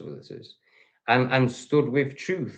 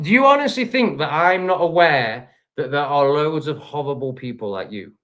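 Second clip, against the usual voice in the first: ordinary talk, nothing marked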